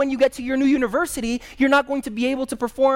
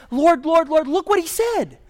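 higher, urgent plea that eases at the end; Hezekiah pleading; 6 semitones higher than usual. Retold or imitated. Hezekiah runs to God about Sennacherib's letters, pleading higher. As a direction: tattle to God like a frightened child. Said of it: "He gets to that temple and he says".

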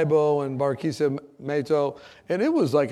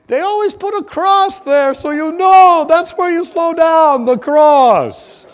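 lady's advice; 12 semitones higher, 12 dB louder, slower than usual. Retold or imitated.

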